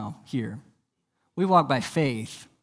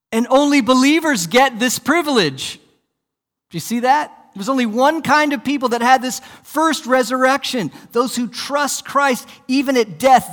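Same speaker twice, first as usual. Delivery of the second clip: raised, emphatic preaching, higher and louder; preaching at full force; 5 semitones higher, 4 dB louder than usual. Real, not acted. Preacher exhorting believers about the first resurrection, full force. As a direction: drive it home to the church.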